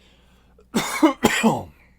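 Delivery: loud hacking cough; hard cough. Real, not acted. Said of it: "It's got me coughing real hard".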